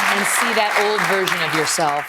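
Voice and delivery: usual speaking voice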